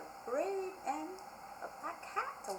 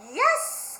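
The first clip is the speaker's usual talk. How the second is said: high loud yes; witch cries yes; 11 semitones higher, 7 dB louder than usual. Acted. Witch cries out welcoming the dog aboard. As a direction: shout it out gladly. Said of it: "cried the witch".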